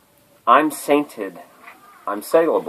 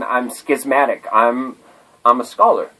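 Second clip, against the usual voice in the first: ordinary talk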